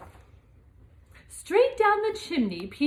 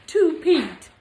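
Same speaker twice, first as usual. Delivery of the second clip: laughing; 4 semitones higher, 8 dB louder than usual. Real, not acted. Reading gift tag, laughing.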